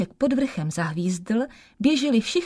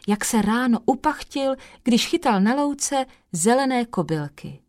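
ordinary delivery, nothing marked

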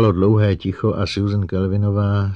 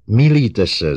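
loud, raised-pitch cry of protest; exclaiming; 6 semitones higher, 9 dB louder, slower than usual. A narrator's reading. Robot contradicting the psychologist, exclaiming loudly. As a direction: call it out forcefully, higher and louder. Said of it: "zvolal Herbie".

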